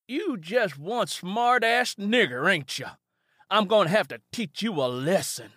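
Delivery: Jed promises to teach Simi a lesson; threatening Jed. Acted.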